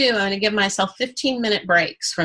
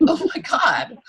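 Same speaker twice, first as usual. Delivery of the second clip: amazed laugh; 7 semitones higher, slower than usual. Pretend. Acting out amazed reaction.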